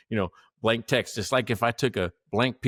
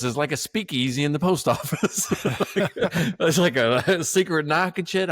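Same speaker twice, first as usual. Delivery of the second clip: laughing through the words, pitched up; 6 semitones higher than usual. Real, not acted. Laughing to a friend about the post office's secret door. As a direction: chuckle out the joke as it lands.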